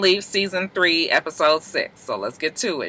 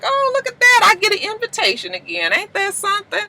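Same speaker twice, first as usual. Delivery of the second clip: high sassy voice. Retold or imitated. Voicing Wicked City Woman.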